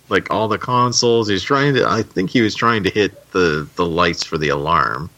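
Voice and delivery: ordinary delivery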